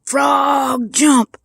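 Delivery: loud, high-pitched order to a frog; drawn-out first word, then a sharp shout; shouted command. Retold or imitated. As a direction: holler it like a command to a stubborn animal. Character repeats command, banging table.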